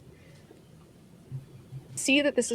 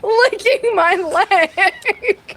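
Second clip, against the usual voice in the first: high, slow, loud wail; crying out; 10 semitones higher, 5 dB louder, much slower than usual. Real, not acted. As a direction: whine it out loud. Player crying out about pet.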